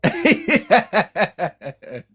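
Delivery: high, bursting real laugh; embarrassed laugh. Real, not acted. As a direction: laugh it off after the sad scene. Reader laughs after sad scene.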